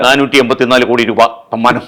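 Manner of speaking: lightly mocked loud news-headline delivery; booming headline read. Shown as a demonstration. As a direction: punch it out like an overemphatic newsreader, half-joking. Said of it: "ശബ്ദമൊക്കെ മുഴക്കി നമ്മൾ പറയുമല്ലോ".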